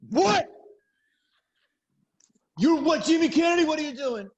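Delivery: loud, high mock disbelief; surprised boyfriend. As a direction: act stunned at the news. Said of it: "And I was like".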